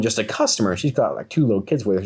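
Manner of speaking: plain reading voice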